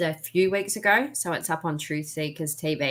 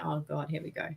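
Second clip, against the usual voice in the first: resigned mutter; 11 dB quieter than usual. Retold or imitated. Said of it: "and I'm like".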